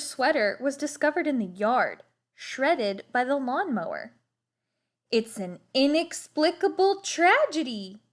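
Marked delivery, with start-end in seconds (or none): 5.1-8.0 put-on a lightly raised, gloomy boy's voice; a touch of mock-tragic boy; Lester's voice. A narrator's reading. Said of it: "said Lester".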